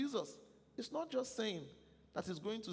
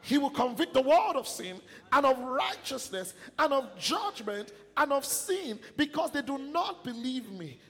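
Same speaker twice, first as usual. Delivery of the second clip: reading scripture aloud at full force, higher; loud scripture reading; 6 semitones higher, 8 dB louder than usual. Acted. Preacher leads the church reading scripture, loud and ringing. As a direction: proclaim the verses with the congregation. Congregation reads verses aloud together.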